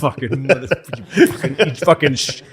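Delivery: high, loud mock rage, laughing through it; mock furious guard. Retelling the guard killing Caligula, hammed-up furious yelling. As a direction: rant like the guard stabbing Caligula. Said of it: "that guard who was just like".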